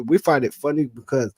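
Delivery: usual speaking voice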